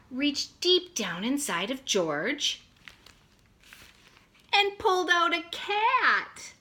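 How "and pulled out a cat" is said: higher, louder reveal; spring the surprise on the kids; surprised reveal. A narrator's reading. Teacher revealing the cat, higher and louder.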